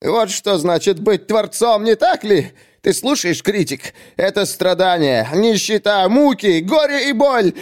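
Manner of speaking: high, theatrical lament; self-pitying poet. Acted. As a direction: declaim your suffering as a misunderstood genius. (высокопарная жалоба страдающего поэта)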